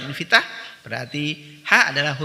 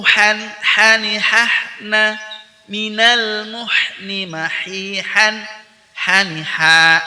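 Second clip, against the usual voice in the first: loud measured recitation; 6 semitones higher, 8 dB louder, much slower than usual. Shown as a demonstration. Teacher reciting practice text for students, loud recitation. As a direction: recite in full slow voice.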